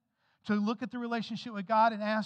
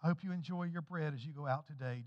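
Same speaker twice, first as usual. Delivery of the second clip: ordinary delivery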